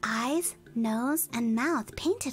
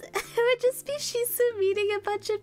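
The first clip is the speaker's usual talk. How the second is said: giggling; 7 semitones higher than usual. Real, not acted. Giggling high while describing.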